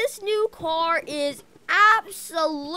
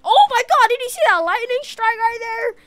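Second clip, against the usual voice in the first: startled by lightning; 8 semitones higher, 8 dB louder than usual. Real, not acted.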